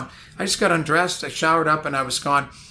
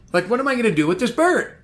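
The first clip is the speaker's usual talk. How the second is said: higher, faster "what am I gonna do with this bird?"; mock panic; 7 semitones higher, faster than usual. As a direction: rush it, panicking.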